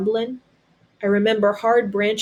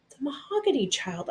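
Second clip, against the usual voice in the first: inner thought; 6 semitones higher, 8 dB quieter than usual. Acted. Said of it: "I thought".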